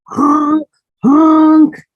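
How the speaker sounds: high, loud honking. A narrator's reading. Reading to a child, the ugly duckling honks instead, loud and high. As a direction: honk like a clumsy goose chick.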